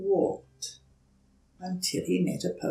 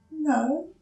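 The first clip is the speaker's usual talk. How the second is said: put-on high, hesitant small-child voice; young turtle politely asking a stranger for help; timid little turtle; 10 semitones higher than usual. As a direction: ask shyly, a little scared. Franklin replies.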